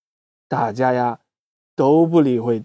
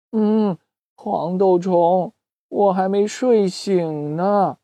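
sleepy; 3 semitones higher, slower than usual. Acted. Doudou half asleep.

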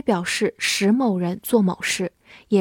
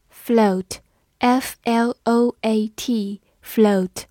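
slow, deliberate letters; teacher spelling aloud slowly; much slower than usual. Shown as a demonstration. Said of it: "spelling the word letter by letter".

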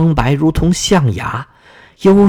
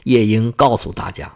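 usual speaking voice

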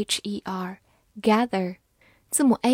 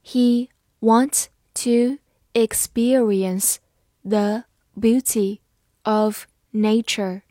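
slow, chunked into phrases; slow practice read; 3 dB louder, slower than usual. Shown as a demonstration. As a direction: read slowly in parts. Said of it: "好，慢读一遍。".

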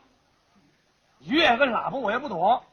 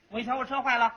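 ordinary delivery

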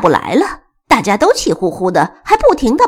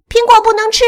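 high, loud protest; high-pitched indignant call; loud cry; 11 semitones higher, 6 dB louder, faster than usual. Acted. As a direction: shout the complaint up high. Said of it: "小斑大声叫道".

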